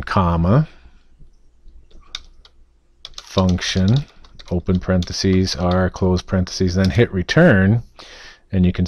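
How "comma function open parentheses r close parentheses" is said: reading code aloud piece by piece; dictating. Shown as a demonstration. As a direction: spell out syntax for students. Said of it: "So we're going to type in".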